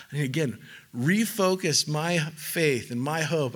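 ordinary talk

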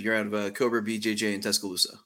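usual speaking voice

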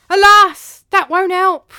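very loud, high-pitched objection; crying out. Acted. Servant stops his master's search plan, crying out. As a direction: cry it out in theatrical dismay. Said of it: "cried Tranio".

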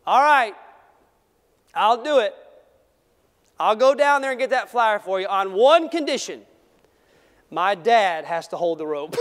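put-on cocky kid laying down terms; a young boy setting his condition; boy's bold bargain. Boy quoted bargaining with men.

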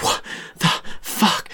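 whispered, stunned disbelief; shocked whisper. Retold or imitated. The crowd gasping at Katniss volunteering, in a shocked whisper. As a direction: breathe it out in shock. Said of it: "And everybody's like".

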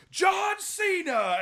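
high, stretched-out yell of the name; hammed-up wrestling announcer shout; mock announcer yell. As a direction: belt it like a ring announcer. Prank callers announce wrestler's name.

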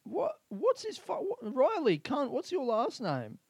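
high-pitched exasperated demand. Real, not acted. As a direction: strain upward, racking your brain for the name. Exasperated at forgetting friend's name.

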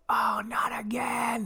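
retold groan. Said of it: "I just heard him go".

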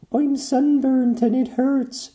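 high, slow wailing mermaid; mermaid crying. A narrator's reading. Mermaid wailing to the flying doctors. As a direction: wail it like a sore mermaid. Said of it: "she cried".

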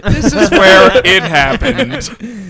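loud, slow dramatic news reporter. Retold or imitated. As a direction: announce it like a TV reporter. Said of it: "They're like".

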